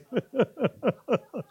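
quiet breathy chuckle; soft laugh. Real, not acted. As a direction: let a small laugh slip. Laughs after joke.

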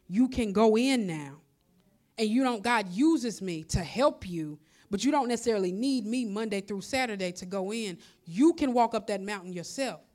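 loud, rising preacher's call; full-force preaching. Acted.

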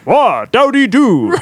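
put-on high, booming game announcer shout, French accent; Smash announcer. Impersonating a French game announcer for friends, big announcer shout. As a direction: announce the fighter's name slowly and grandly. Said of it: "Imagine the Smash announcer saying that".

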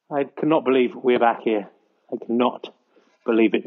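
a hint of a gloomy co-commentator; second commentator voice. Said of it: "Back to commentator one now".

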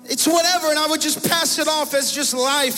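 high and loud, impassioned sermon climax; preaching at full force. Acted. Pastor confessing to the congregation, impassioned and loud. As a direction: preach it, climbing with each line.

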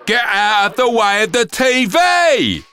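high, loud bratty yell; shouted. Acted. Girl yelling at helpers blocking the TV, loud and bratty. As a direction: yell it like a spoiled kid. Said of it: "shouted Sophia".

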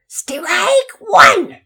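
drawn-out, high shrieking call; umpire's shriek. A narrator's reading. Umpire calls the pitch, very loud high shriek. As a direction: belt it out like a startling umpire. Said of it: "the umpire yelled, surprising Pearson with his shriek".

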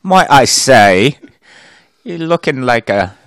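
hammed-up British, starting high then settling; British accent. Co-host as British diver flattering a lass, mock British accent. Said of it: "He, he's a Brit".

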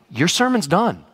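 ordinary delivery

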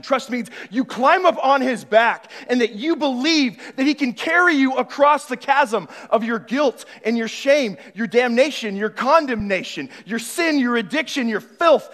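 loud, high rising preacher's list; preaching at full force. Acted. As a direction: hammer each burden home.

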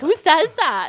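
high, loud scoffing with a laugh; laughing disbelief. Retold or imitated. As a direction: laugh it off like mom. Mom scoffing, retold.

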